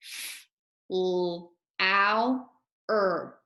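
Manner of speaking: each sound said separately: L, ow, r. Shown as a demonstration. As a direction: sound out L, ow, r one by one, like a teacher. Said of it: "make the sounds in this word".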